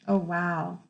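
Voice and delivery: ordinary delivery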